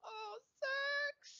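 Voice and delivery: put-on very high, drawn-out German-accented call; German-tinged swoon. Admirers calling their idol, German-tinged swoon. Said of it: "like, part German now".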